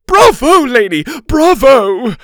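high, very loud bravo; gushing teacher's ovation; cheering. A narrator's reading. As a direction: cheer at full voice, high-pitched. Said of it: "Missus Arthur cheered".

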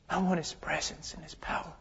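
whispered, earnest longing; very soft, almost voiceless plea; yearning hush. Real, not acted. Earnest longing, voice drops away.

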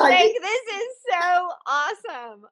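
high, loud laughing delight. Real, not acted. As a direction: squeal it out through laughter. Host reacts to the story.

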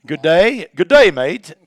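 hammed-up Australian accent. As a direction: try an Aussie greeting, half-hearted. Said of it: "I'm terrible at accents".

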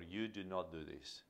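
quiet, firm parent voice; firm but soft. Shown as a demonstration. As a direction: set the rule calmly and softly. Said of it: "but in a firm way".

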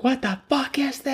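very high, panicked squeal; freaked-out shriek. Pretend. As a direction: shriek it in mock horror. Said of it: "I would have been like".

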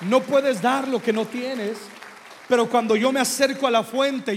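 loud, rising sermon climax; full-force preaching. Acted. Preacher urges the church to clap for God. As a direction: shout it to the back of the church.

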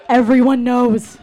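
loud cry of dismay; horrified outburst. Acted. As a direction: blurt it out loudly, mortified. Horrified punchline after public comment.

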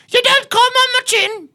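shrieking, very high mock British; British accent. Crude offer to co-host, screeched fake British accent. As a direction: shout it in a piercingly high, silly British voice. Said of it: "Can you do a British accent?".

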